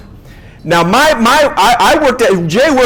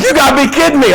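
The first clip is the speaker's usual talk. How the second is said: disbelief; 7 semitones higher, faster than usual. Retold or imitated. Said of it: "It's like".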